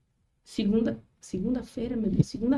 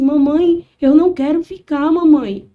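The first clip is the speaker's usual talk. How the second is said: higher and much louder, a son's tearful plea; crying child begging; 6 semitones higher, 11 dB louder than usual. Retold or imitated. Son begging his mother not to be left behind, loud tearful cry. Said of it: "E ele chorava".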